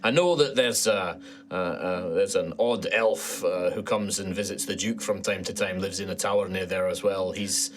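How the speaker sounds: helpful Scottish knight; chatty Scottish dwarf guard; Scottish accent. Acted. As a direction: give directions in a Scottish lilt. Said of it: "Okay, it was Scottish".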